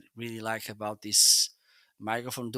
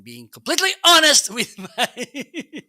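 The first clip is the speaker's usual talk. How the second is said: laughing; 10 semitones higher, 9 dB louder than usual. Real, not acted. Laughs while claiming honesty.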